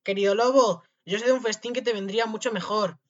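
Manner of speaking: high, loud calling voice; shouting. A narrator's reading. Tom Thumb from the wolf's belly, shouting temptingly. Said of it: "se puso a gritarle".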